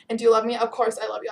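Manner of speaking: ordinary delivery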